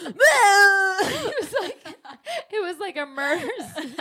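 laughing through the words, voice jumping high; can barely speak from laughing. Real, not acted. Laughing while retelling vomit story.

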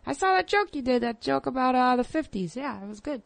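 high-pitched mock comic voice; Bill Burr. Guest retells a comic praising her stage joke, high impression. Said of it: "Bill Burr told me a long time, he goes".

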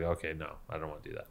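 very soft, low retold retreat; backing down. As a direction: imitate someone meekly dropping the fight. Retold opponent backing down from fight.

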